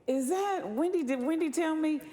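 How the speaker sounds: high-pitched, loud mock disbelief; astonished reader. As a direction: imitate a reader shocked by family gossip. Acting out a scandalised reader.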